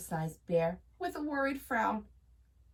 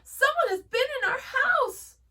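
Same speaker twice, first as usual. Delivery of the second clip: high, loud and worried; alarmed; 6 dB louder than usual. A narrator's reading. Mama Bear warns the bears, high and alarmed. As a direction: sound the alarm to the family. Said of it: "said Mama Bear".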